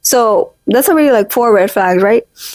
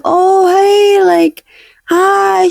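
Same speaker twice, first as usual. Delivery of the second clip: high phone greeting; 6 semitones higher, much slower than usual. Retold or imitated. Answering the recruiter's call.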